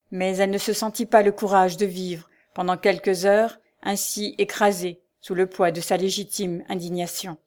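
plain reading voice

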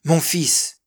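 a father's shocked exclamation; stricken cry. A narrator's reading. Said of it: "qui ne put articuler que ces mots".

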